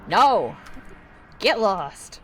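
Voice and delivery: loud, curt shout through a door; irritated neighbour's shout; brusque dismissal. Acted. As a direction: send the caller packing, loudly. Acted neighbour turns caller away.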